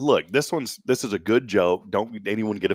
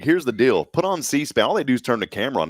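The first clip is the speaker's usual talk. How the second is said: ordinary talk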